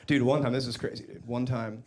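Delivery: ordinary talk, nothing marked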